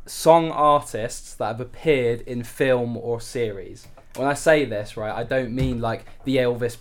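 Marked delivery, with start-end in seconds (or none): 0.0-3.9 each word spelled out slowly; slow and deliberate. Real, not acted. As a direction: announce the topic slowly and clearly. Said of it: "I'll just make sure you're clear on it".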